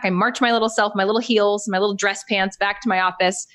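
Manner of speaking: usual speaking voice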